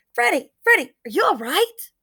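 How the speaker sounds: high, repeated name then worried question; worried mother rushing to a fallen child; alarmed concern. A narrator's reading. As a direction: call the name urgently, pitch jumping up.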